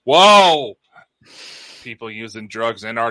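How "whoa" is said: big, high, drawn-out whoa; loud whoa. Real, not acted. Co-host reacting to chat, loud whoa. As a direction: let out a loud startled whoa.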